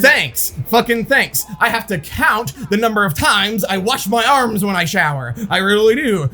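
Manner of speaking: high, indignant mock rant; exasperated retort. Sufferer answering a coworker who claims to relate, exasperated sarcasm. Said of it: "And I'm like".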